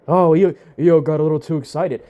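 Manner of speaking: light mock voice of the excuse-makers. Host parodies fans excusing Io, light mock voice. Mocking fans who defend Io.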